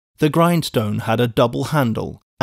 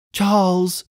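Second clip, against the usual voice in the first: high-pitched anguished name; imploring; 6 semitones higher than usual. A narrator's reading. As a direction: utter the name as a plea. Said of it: "she panted out in his arms imploringly".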